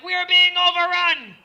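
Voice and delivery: high, very loud battle cry in a fake Swiss accent; panicked soldier's yell, fake Swiss accent; mock Swiss accent shout. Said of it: "What's a Swiss accent?".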